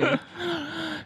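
high soft giggle. Real, not acted. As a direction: squeak a quiet giggle. Host giggles quietly.

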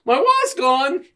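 high, loud, panicked granny cry; mock old lady shrieking. Old lady yelling that her purse was robbed, high and loud. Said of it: "This old lady was just like".

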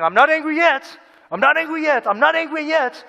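high, tight, mock-sweet denial; passive aggressive. Preacher mocking a passive aggressive believer, high and sing-song. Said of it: "that which is lurking in our tone".

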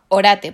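orate stressed on the middle syllable RA, loud; corrected stress. Shown as a demonstration. Player corrects her friend's accent, orate stressed on RA. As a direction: land firmly on the RA in orate. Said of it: "no tiene tilde en la O".

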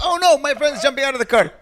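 high, loud, laughing fake panic; badly faked shock, playing it up as a joke; mock alarm. Listener mockingly acts out fake alarm.

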